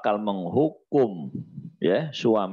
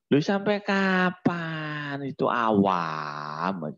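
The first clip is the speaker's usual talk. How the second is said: usual speaking voice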